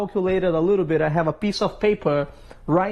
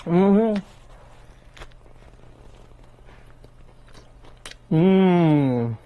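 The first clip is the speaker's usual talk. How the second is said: appreciative hum. Real, not acted. Tasting the sandwich.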